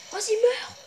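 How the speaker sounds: high rapid-fire chant, fading out; frantic urging. Real, not acted. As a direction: chant it high and urgent, then let it fade. Urging the boss to die.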